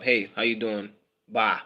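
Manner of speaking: ordinary delivery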